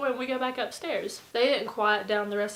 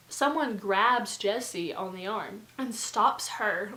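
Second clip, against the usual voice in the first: ordinary delivery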